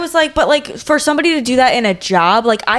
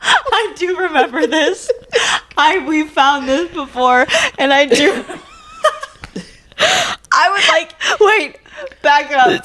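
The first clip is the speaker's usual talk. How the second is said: slow, higher, laughing; 7 semitones higher than usual. Real, not acted. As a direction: remember it while cracking up. Laughing at letter.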